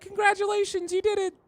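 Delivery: high, cartoonish cheer; squeaky congratulating voice. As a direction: chirp it like a tiny cheering family. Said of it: "They're like".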